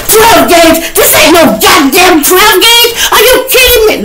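shrieking very high, loud and stunned; freaking out. Retold or imitated. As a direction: lose it at the sight of the gun. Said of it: "man, he tweaking".

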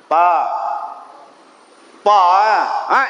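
loud, brusque "Pa lah"; mock rude reply. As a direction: snap back carelessly. (balasan kasar yang diejek)

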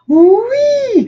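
high, loud shout; shouted yes. A narrator's reading. Le caissier crie oui au papa, aigu et fort. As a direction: top his no with a yell. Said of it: "crie le monsieur".

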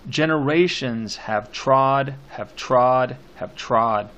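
slow, heavy tread on each "trod"; poem recitation. Shown as a demonstration. Teacher scanning the poem's repeated line, slow emphatic recitation. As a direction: pound out each "trod", stepping down in pitch.

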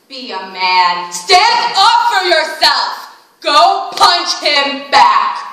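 loud, macho taunting voice; tough guy barking orders. Retold or imitated. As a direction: bark it like a schoolyard bully.

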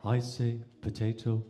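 posh English, low and even; posh English accent. Acted. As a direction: play a very posh English gentleman. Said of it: "a very posh Englishman".

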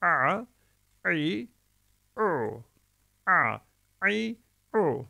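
"A, i, u" each pressed from a tight throat; ayn vowel drill. Shown as a demonstration. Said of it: "bunu da böyle çıkartacağız".

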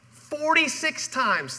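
"Forty-six times" said slowly for note-taking; slow dictation. Shown as a demonstration. Pastor gives the fill-in answer, slow and deliberate. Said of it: "it's the blank if you're filling it in".

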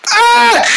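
short, loud, high yelp; pained scream. Acted. Victim being hit by his friend, pained scream. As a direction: scream as if struck.

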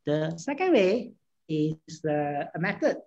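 ordinary delivery

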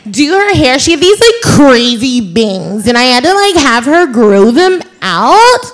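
put-on high, girlish Valley girl; Becky voice. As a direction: gush like a college girl on a phone line. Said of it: "my voice was somewhere around here".

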